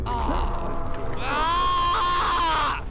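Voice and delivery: long loud high scream; frightened scream. Real, not acted. Player scared after missing the text, long frightened scream. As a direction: scream out in shock. Jump scare in horror game.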